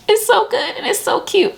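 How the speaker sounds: very high-pitched gushy squeal; gushing squeal. Real, not acted. Vlogger teasing the last book she read, gushing squeal. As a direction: squeal it in a tiny, high, adoring voice.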